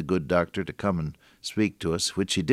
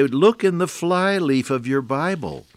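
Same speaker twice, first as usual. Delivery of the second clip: lightly voiced whimsical teasing question, higher; whimsical old friend; 7 semitones higher, 3 dB louder than usual. Preacher retelling his friend's playful question to radio listeners, whimsical and higher. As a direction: tease the congregation with a playful riddle. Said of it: "And then he would say".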